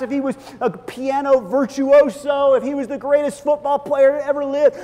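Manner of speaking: mock yearning rising to a high, loud peak; yearning wish list. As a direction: pile up wishes for the perfect life. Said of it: "You get thirsty again".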